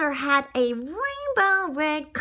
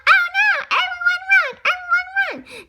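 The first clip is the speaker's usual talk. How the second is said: very high, loud panicked duck voice; 6 dB louder than usual. Acted. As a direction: squawk it out in fright. Duck tells everyone to flee.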